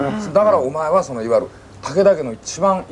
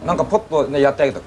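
ordinary delivery

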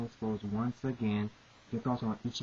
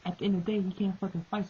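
usual speaking voice

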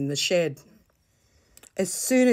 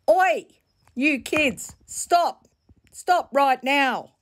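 high, loud "Oi" building to a slow commanding "stop right now"; auntie shouting; 10 semitones higher, 5 dB louder, much slower than usual. Acted. Auntie Pearlie yelling at the muddy kids to stop, loud and slow. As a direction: call the kids out of the mud like a cross aunt. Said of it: "Auntie Pearlie's voice rang out".

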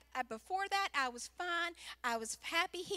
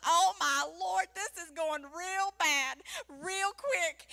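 loud, slow, drawn-out dread; hammed-up alarm; acted panic; 2 semitones higher, 6 dB louder, slower than usual. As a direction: play up the panic, stretching the words. Retells panic over gun.

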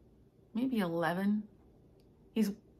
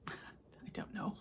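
whisper; 14 dB quieter, slower than usual. Real, not acted. Quiet shrug-aside.

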